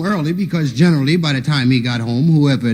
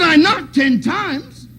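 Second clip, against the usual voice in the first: high, slow indignant yell; indignant shout; 12 semitones higher, much slower than usual. Retold or imitated. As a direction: shout it out, wounded. Uncle complains indignantly.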